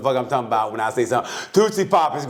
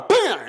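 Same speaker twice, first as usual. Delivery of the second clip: high, abrupt bam; imitated gunshot; 13 semitones higher than usual. Man giving numbers is shot mid-sentence, imitated gunshot. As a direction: interrupt with a mock gunshot. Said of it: "He's like".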